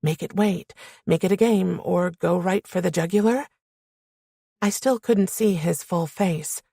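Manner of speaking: ordinary delivery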